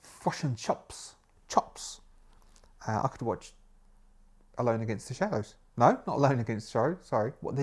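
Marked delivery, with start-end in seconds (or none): 0.0-2.0 slow, hushed New Zealand vowels; New Zealand accent. Shown as a demonstration. Host shows how New Zealanders say it, slow New Zealand accent. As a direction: demonstrate New Zealand "fush and chups". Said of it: "the distinctive way that a New Zealander would say".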